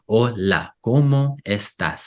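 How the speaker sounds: "Hola, ¿cómo estás?" slowly, every syllable given its full vowel; exaggerated syllable timing. Shown as a demonstration. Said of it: "exaggerating right now".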